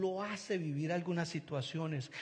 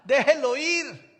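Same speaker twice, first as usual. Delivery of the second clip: slow, much louder and higher "Déjelo ir"; carefree loud call; 8 semitones higher, 12 dB louder, slower than usual. Retold or imitated. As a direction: wave it off with a big carefree shout. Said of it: "el otro que no es catastrófico". ("Déjelo ir" gritado, lento y despreocupado)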